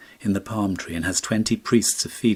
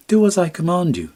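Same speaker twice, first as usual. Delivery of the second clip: higher, louder royal command; commanding prince; 11 semitones higher, 7 dB louder than usual. Acted. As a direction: order him firmly, like a prince. Prince orders the Swallow.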